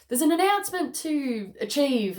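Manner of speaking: lightly hammed-up herald; theatrical announcement. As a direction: announce it grandly, half in jest. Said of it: "coming through as a theatrical thing".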